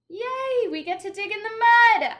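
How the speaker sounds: high, loud gleeful shout; excited shout. Acted. Child cheering about digging in mud, shouted. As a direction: cheer at the top of your lungs. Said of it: "I shouted".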